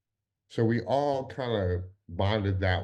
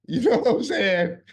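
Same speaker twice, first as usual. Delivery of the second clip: laughing out loud, much higher and louder; real laugh; 13 semitones higher, 8 dB louder than usual. Real, not acted. He laughs while joking with his co-host about Kim's reaction. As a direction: burst out laughing through the words.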